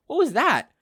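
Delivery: high, alarmed question; startled woman. Acted. Inko hearing a rumble outside, high and alarmed. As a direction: jump at the noise, voice high.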